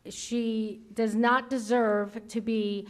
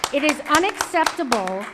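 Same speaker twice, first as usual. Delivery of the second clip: ordinary delivery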